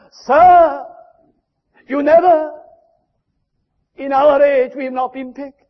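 a loud, high plea to God, voice lifted; pleading cry. Acted. The church crying to God to be picked, loud plea. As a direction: cry out like the neglected boy.

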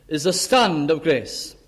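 usual speaking voice

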